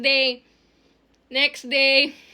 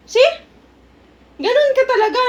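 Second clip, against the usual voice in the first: put-on high kid voice; 8 semitones higher, 5 dB louder than usual. Kid retorts to principal.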